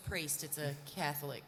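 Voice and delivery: quietly matter-of-fact, no reaction at all; deadpan. Retold or imitated. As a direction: repeat the correction utterly deadpan. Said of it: "as deadpan as you've ever heard".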